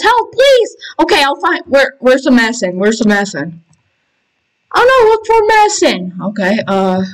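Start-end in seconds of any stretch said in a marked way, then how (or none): 0.0-0.8 high-pitched mock plea; pleading for help. Begging help for choking player. 4.7-6.2 high, fretful game alarm; mock urgency. Urgent search for medicine.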